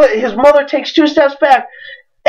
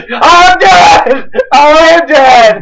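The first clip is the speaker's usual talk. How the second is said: higher, louder panicked cry; mock panic; 5 semitones higher, 5 dB louder, much slower than usual. A kid panicking as his dad walks in. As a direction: yell it like doom is coming.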